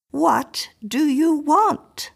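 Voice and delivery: "What do you want?" repeated very slowly, rising on "want"; slow repeated question. A narrator's reading. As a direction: drag out each word of "What do you want?". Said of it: "she repeated".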